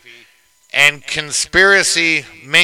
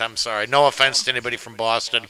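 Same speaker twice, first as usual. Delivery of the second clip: ordinary talk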